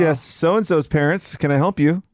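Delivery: chirpy receptionist answering. Pretend. Receptionist voice, scheduling baby visits.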